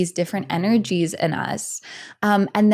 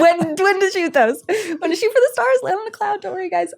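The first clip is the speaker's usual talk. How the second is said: much higher, laughing, mock-lofty reassurance; mock grand promise; 13 semitones higher, 4 dB louder than usual. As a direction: soar up high like a cheesy coach. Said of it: "and I'm like".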